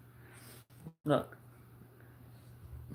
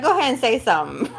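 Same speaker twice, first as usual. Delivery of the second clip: dog's sassy pretend voice; 5 semitones higher, 5 dB louder, much faster than usual. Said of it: "She's like".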